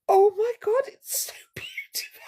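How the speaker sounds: high, whispered mock gasp of delight; hammed-up breathless awe; breathless gushing. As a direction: imitate her swooning over her own hair. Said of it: "She's like".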